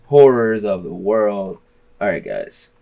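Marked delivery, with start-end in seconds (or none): 0.1-1.6 slow, drawn-out segment title; announcer read. Acted. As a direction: stretch out the title like a host.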